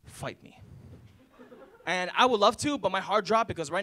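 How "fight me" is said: whispered duel dare; hushed challenge. Retold or imitated. Little brother challenging Ahmed to a lightsaber fight, whispering. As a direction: breathe it low like a secret duel. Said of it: "He looks at me, he goes".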